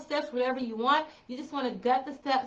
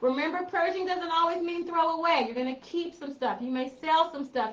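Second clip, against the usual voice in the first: usual speaking voice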